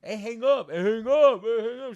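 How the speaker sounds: very loud, high repeated yell; background heckling. Retold or imitated. Man behind girlfriend telling her to hang up, shouting. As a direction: holler it over someone's phone call.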